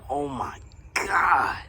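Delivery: slow, breathless whisper of awe; hushed amazement at the sub's size; awed whisper. Real, not acted. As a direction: whisper it like you can't believe it.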